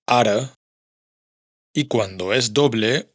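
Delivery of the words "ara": "ara" con erre suave de un solo toque. Shown as a demonstration. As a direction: pronuncia "ara" con una vibración breve y suave. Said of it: "como en". (erre simple en "ara")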